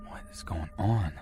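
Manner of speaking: hushed, voiceless whisper to oneself; soft whispered self-talk. Acted. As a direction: whisper it under your breath, as if thinking aloud. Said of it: "whispering to myself".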